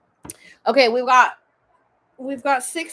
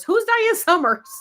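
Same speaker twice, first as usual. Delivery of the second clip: puzzled; 11 semitones higher, 5 dB louder than usual. Real, not acted. Puzzled at own slip.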